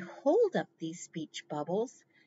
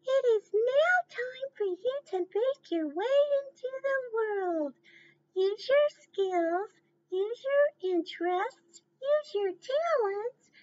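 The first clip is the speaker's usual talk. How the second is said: much higher, tearful motherly pig voice; mommy pig. Acted. Mommy pig tearfully sending her piglets into the world, much higher. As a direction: send the piglets off with a catch in the voice.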